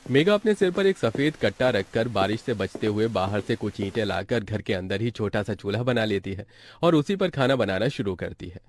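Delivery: ordinary delivery, nothing marked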